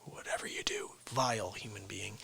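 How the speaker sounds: near-whispered warning; hushed aside. Pretend. Host warning viewers not to vote for him, hushed aside. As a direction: drop to a low, confidential murmur.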